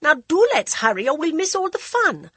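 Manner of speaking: high, quick excited urging; bright hurry-up call; eager urging. Acted. Lady Modi urging hurry.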